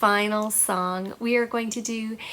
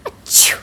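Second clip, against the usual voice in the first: sneeze; 10 dB louder than usual. Acted. Friends start sneezing.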